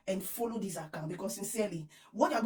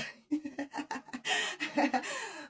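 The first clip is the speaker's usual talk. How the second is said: high gleeful laugh; delighted laugh; 6 semitones higher than usual. Real, not acted. Laughing about the hottest news.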